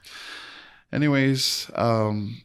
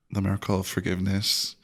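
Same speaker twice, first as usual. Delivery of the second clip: ordinary delivery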